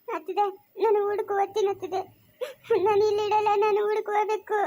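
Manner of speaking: tearful whining insistence; crying. Acted. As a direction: insist on leaving through sobs. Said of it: "ಅಳ್ಬೇಡ, ಸುಮ್ನೆ ಇರು". (ಅಳುತ್ತಾ ಹಠ ಹಿಡಿಯುವುದು)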